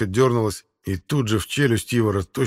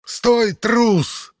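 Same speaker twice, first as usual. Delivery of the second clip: very high, enraged shout; furious roar; 12 semitones higher, 4 dB louder than usual. A narrator's reading. War leader to his fleeing foe, furious roar. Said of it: "яростно зарычал Хаук".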